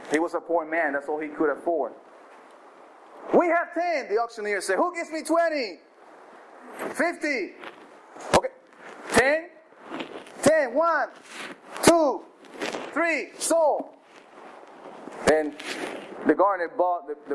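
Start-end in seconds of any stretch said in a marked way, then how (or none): 3.3-13.9 auctioneer closing the sale. Acted. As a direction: rattle off the bids and bring it home.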